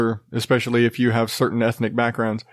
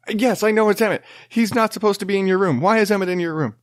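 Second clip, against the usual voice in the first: higher, fed-up dad reply; exasperated dad; 8 semitones higher, faster than usual. Retold or imitated. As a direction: sound worn out and annoyed.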